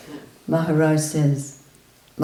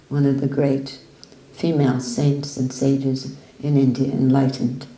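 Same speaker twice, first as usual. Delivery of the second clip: ordinary talk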